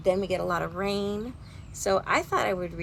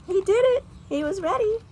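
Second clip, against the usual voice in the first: proud excitement; 13 semitones higher, 7 dB louder than usual. Real, not acted. Teacher proud of robin.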